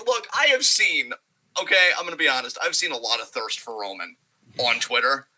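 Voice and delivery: normal talking voice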